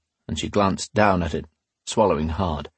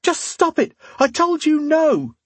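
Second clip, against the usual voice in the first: shrieked protest, very high; screamed. A narrator's reading. Woman making the tavern think he attacks her, screaming. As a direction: scream it out, dragging the words. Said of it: "she screamed".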